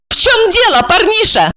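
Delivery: very high, threatening woman; angry cry. A narrator's reading. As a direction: lash out in a high-pitched voice. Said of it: "оттолкнула его и закричала".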